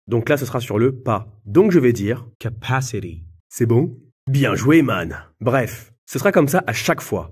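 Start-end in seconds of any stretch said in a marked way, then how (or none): 1.4-3.2 "capacity" with the stress on "pa", slower. Shown as a demonstration. Teacher models the right stress on "capacity" slowly. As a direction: land hard on the "pa" of "capacity". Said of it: "accent sur l'avant-avant-dernière syllabe".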